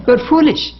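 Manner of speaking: high, playful scolding; teasing rebuke. Real, not acted. Monk teasing devotees who meditate before Mother, playful. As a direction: tease them as foolish, rising.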